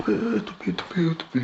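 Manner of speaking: mocking squeaky voice. Mocking voice of protesters.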